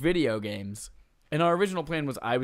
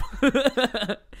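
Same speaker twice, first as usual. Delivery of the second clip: high giggle; 13 semitones higher, 6 dB louder than usual. Real, not acted. Laughing at own accent bit.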